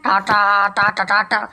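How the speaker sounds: Tata said as totot oto in a strange clogged-throat voice; changed choked voice. Acted. The Santa statue bidding goodbye in a choked throat voice. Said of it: "മാമൻ എന്താ ശബ്ദം മാറ്റി ... തൊണ്ട അടഞ്ഞോ".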